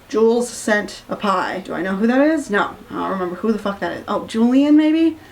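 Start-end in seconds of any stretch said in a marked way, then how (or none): none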